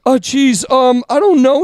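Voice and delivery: high-pitched imitation of a panicked dither; flustered customer. One guy who couldn't order fast enough, flustered. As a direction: mimic a customer stumbling under pressure. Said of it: "everyone flustered".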